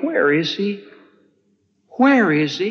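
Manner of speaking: high, rising, anxious repeated question; anxious pleading. Retold or imitated. Sisters asking where Jesus is, high anxious pleading. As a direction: plead for the missing friend, voice climbing. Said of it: "Martha and Mary are saying".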